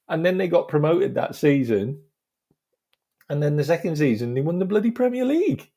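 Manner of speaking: high voice climbing to a squeal; laughing amazement. Real, not acted. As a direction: build in giddy disbelief. Amazed laughing end of story.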